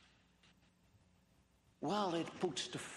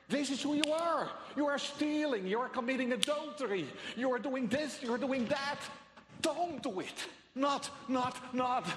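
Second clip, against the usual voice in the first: raised, louder accusing preaching; forceful, compelling; 6 semitones higher, 4 dB louder than usual. Acted. The law as mirror accusing the sinner, forceful. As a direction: confront the listener head-on, high and insistent. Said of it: "very forceful and compelling way of speaking".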